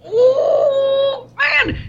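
high excited ooh; excited squeal. Real, not acted. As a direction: squeal with delight. Excited reaction.

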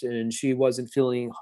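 ordinary talk, nothing marked

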